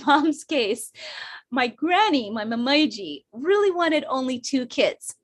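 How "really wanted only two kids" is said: ordinary delivery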